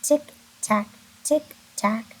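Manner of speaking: crisp clock-like tick, tack. Shown as a demonstration. Teacher ticking like a clock for the class. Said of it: "your teeth are kinda together".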